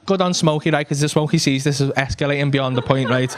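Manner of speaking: ordinary talk, nothing marked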